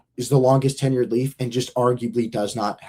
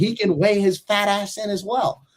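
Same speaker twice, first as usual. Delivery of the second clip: Phil; 9 semitones higher than usual. Retold or imitated. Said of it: "And then he's like".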